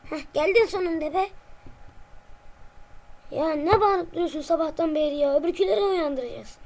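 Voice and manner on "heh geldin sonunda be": ordinary talk, nothing marked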